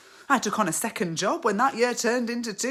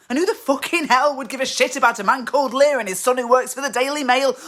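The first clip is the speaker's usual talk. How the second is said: loud, higher-pitched angry tirade; scornful rant; 6 semitones higher, 7 dB louder than usual. Acted. Wife rubbishing her husband's novel, angry scorn. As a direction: tear into it at full volume, pitched up.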